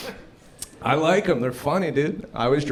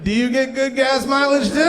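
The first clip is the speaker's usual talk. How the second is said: high, loud calling across cars; hammed-up car-to-car holler; mock excited shout; 13 semitones higher, 6 dB louder than usual. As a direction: yell it eagerly to another driver. Shouting between passing Prius drivers.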